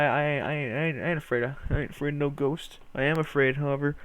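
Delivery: normal talking voice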